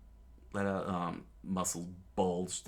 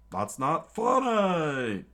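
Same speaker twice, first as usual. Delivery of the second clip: higher, louder slow shout; angry scream; 9 semitones higher, 7 dB louder, much slower than usual. Acted. Cook Patty yelling at Luffy, angry slow shout. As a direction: yell it in outrage. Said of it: "Patty screamed out".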